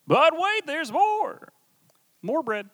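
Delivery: high, punchy TV-salesman "but wait"; infomercial pitch. Pretend. Preacher doing a game-show pitch voice. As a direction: sell it like a late-night ad.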